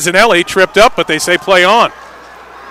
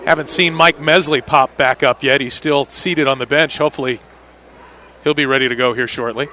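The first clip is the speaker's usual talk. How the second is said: ordinary talk, nothing marked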